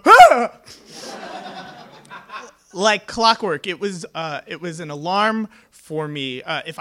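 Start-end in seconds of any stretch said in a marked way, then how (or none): 0.0-0.6 short, high, loud yelp of terror; mock night-terror scream. Father waking from night terrors, high imitated scream. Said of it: "my father would go".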